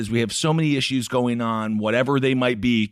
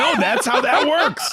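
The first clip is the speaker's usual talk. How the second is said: laughing shout; 3 dB louder, slower than usual. Real, not acted.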